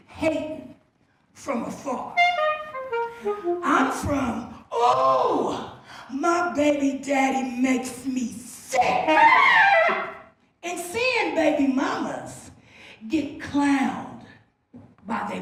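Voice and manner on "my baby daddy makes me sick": ordinary talk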